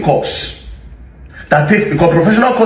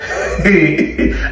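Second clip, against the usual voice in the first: a short breathy laugh at his own trick; amused laugh. Real, not acted. Commentator laughing to viewers at the minister's rebuttal.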